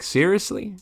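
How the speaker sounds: ordinary talk, nothing marked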